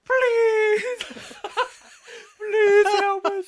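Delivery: put-on high, loud crying-kid plea, laughing through it; pity plea. Pleading with listeners to send love, in a hammed-up crying-kid whine. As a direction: beg like a crying child, hammed up. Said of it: "Now you have to make a really pity, pity face".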